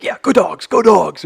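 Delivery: mock fan cheer. Comparing the scene to sports fans, mock cheering. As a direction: cheer like a fan rooting for the team. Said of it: "rooting for their favorite person".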